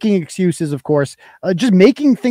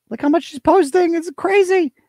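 higher-pitched disbelief; incredulous; 9 semitones higher than usual. Real, not acted. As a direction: exclaim it in amazed disbelief. Scrolling in disbelief at posts.

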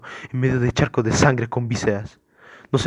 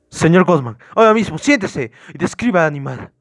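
raised, higher-pitched commanding voice; stern order; 5 semitones higher, 5 dB louder than usual. Acted. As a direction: take control of a frantic suspect. Officer orders frantic suspect to sit.

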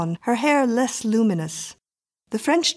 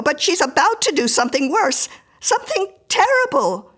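high, loud, agitated woman; acted panicky pleading; frightened Mrs Miller; 10 semitones higher, 5 dB louder than usual. A narrator's reading. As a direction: pour out your fear to strangers, stumbling and urgent.

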